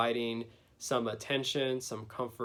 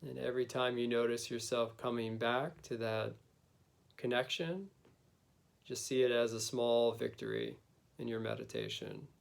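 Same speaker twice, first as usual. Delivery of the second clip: guided meditation. Acted. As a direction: gently lead them inward, unhurried.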